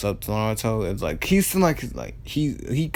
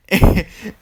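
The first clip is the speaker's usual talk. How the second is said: a sudden, high, loud laugh; loud burst of laughter; 9 semitones higher, 11 dB louder than usual. Real, not acted. Teller cracking up at Naruto's comeback, loud laugh. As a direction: crack up at your own joke.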